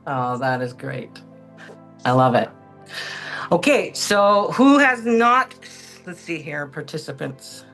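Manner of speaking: ordinary delivery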